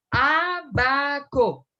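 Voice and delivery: "abaco" drawn out slowly as Á... ba... co; syllable by syllable. Shown as a demonstration. Teacher demonstrating separación silábica, "abaco" slowly split into a-ba-co. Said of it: "la puedo pronunciar sola".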